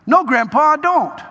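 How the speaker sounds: put-on high, small boy's reply; little boy voice. Grandson answering grandpa, high boyish voice.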